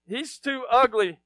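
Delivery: ordinary talk, nothing marked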